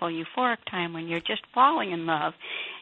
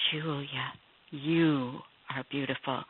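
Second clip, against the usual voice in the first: soft whisper; 3 semitones lower, slower than usual. Retold or imitated. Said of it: "I thought he said".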